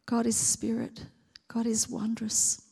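hushed, awed whisper. Acted. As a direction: breathe it in wonder.

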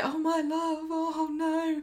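soft, high-pitched mock lament; imitated motherly wailing, hushed; Audrey. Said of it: "Audrey's like".

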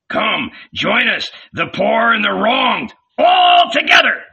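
high, building to a shout; rousing call to join; rallying call. A narrator's reading. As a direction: summon them, rising to a yell. Red beard rallies crowd.

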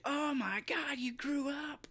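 high, ending in a whisper; shocked Fred. Retold or imitated. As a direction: gasp then whisper the shock.

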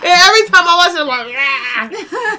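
high, loud words cracking with laughter; half laughing, half wailing in embarrassment; tearful laughter; laugh-crying. Real, not acted. Laughing about own teeth.

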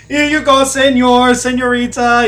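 high, loud fake Spanish accent waiter; overdone fake Spanish accent. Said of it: "drops his fake fucking Spanish accent".

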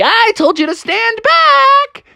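slow, very high character yell; weasel scolding. Acted. Weasel janitor scolds Froggy mid-chop, high drawn-out yell. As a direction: stretch it into a shrieked telling-off. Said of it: "the weasel was like".

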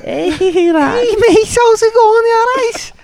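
very high, slow cartoon British greeting; hammed-up posh English mate voice; mock British accent.

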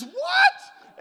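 huge high shrieking what; shout. Acted. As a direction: scream it, outraged and disbelieving. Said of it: "He looks at her and he says".

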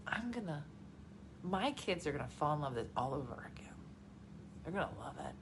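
soft, near-whispered fond hope; low-volume, breathy confiding; hushed anticipation. Retold or imitated. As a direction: say it softly, dreamily hopeful. Imagines tender hope for kids.

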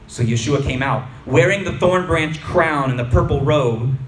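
ordinary delivery

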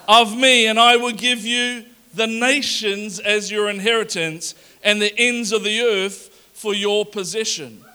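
loud, fervent scripture read; preacher booming the promise; enthusiastic reading. Shown as a demonstration. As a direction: proclaim it with energy. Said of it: "I'm being more enthusiastic".

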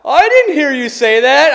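very loud, high mock kid voice; mimicked kid's excuse. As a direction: blare the excuse like a protesting child. Sons' excuse, acted out.

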